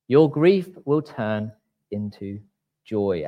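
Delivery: preacher reading the verse to the church, slow and emphatic; scripture reading. Acted. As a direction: proclaim each phrase with pauses. Reading John 16:20 aloud.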